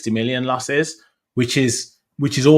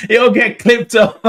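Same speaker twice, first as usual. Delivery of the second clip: laughing; 11 semitones higher, 9 dB louder, slower than usual. Real, not acted. Laughing protest.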